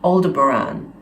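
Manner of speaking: Aldebaran said "ol-de-buh-RAHN"; channeled pronunciation of Aldebaran. Shown as a demonstration. Citing the Martians' way of saying it, Aldebaran with "-rahn". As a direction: finish Aldebaran on an open "ahn". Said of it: "they also pronounce it".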